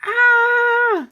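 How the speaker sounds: put-on terrified boy scream; high scream. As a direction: shriek in fright. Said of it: "ran screaming".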